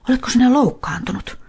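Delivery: put-on high, worried boy's question; a boy asking the hurt dog, up high; concerned boy. A narrator's reading. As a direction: Niko checking on the limping dog, high and worried. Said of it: "Niko kysyi".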